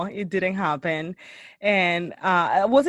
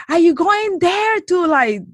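loud, high-pitched shouted question; imitated yell; 9 semitones higher, 6 dB louder than usual. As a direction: holler it like a stranger from a car. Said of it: "he yells at me".

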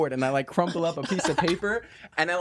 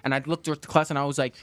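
ordinary talk

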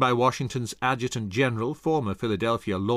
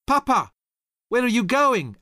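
put-on high, loud boy calling after father; child shouting; 13 semitones higher, 6 dB louder than usual. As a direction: call out to papa as he leaves. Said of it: "Alex shouted".

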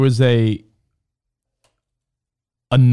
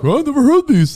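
mocking kids; 13 semitones higher, 3 dB louder than usual. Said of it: "And they're like".

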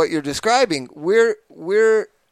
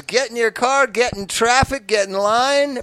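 grand entrance announcement; 7 semitones higher than usual. Acted. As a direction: roll out the welcome like a ringmaster. Mock show-host intro of podcast.

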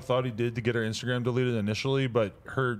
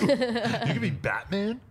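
incredulous; 9 semitones higher, much slower than usual. Real, not acted. Friend doubts Fortnite claim.

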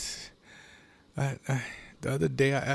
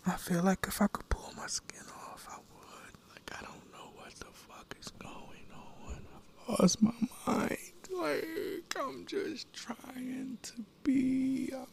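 desperate whisper; 11 semitones higher, 5 dB quieter than usual. Real, not acted. Man losing his grip, whispering he's lost his mind. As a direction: whisper in real panic, close to breaking. Panic, wants to pull skin off.

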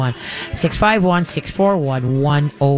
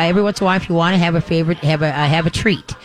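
usual speaking voice

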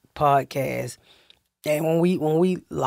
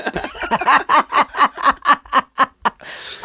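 high, long burst of real laughter; laughing; 6 semitones higher than usual. Real, not acted. Comedian cracks up after mimicking her shocked family, laughing. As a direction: crack up at your own story.

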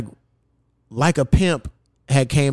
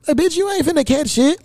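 exasperated; 13 semitones higher than usual. Real, not acted. Ranting at anti-vax women.

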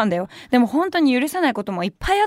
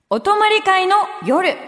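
higher, slow, drawn-out title call; corner title announcement; 8 semitones higher, slower than usual. Acted. Radio host announces the sleepover corner title, slow and lilting. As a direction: announce the segment name with flair.

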